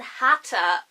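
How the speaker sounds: "Hatta" with a clear, crisp T; slow, deliberate "Hatta" with the T sounded; emphatic crisp T. Real, not acted. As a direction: land on a distinct T in "Hatta". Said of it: "I don't know why I said it like that".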